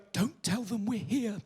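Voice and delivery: soft, slow mock-secretive voice; furtive hush. Small audience in Horsham hoping not to be noticed, furtive hush. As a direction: imitate people hiding, hushed and drawn out.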